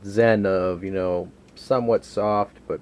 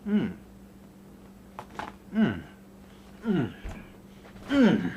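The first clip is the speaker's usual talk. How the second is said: hammed-up mmm, each one rising higher; exaggerated mmm of delight; 8 semitones higher, slower than usual. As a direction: overplay how tasty the bite is. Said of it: "Over-exaggerated".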